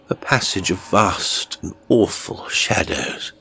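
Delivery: ordinary delivery, nothing marked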